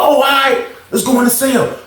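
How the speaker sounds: shouted "come on then" call to fight; mock challenge. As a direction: bark it out as a dare to step inside. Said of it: "He was like".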